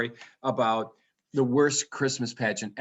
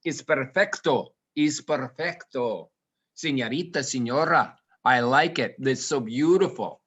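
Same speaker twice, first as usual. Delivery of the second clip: put-on admiring Spanish-accented flourish. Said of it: "I love in español".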